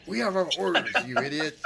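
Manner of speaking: high-pitched, exasperated retort; defensive guard. Acted. Guard to sympathetic colleague, defensive retort. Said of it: "He's like".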